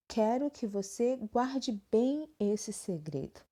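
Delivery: slow, deliberate reading of the example; slow example sentence. Shown as a demonstration. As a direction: read it out slowly for the class. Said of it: "quando eu digo assim".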